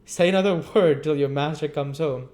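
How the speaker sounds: pompous orders. Acted. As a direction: lay down the law. Bumble ordering them.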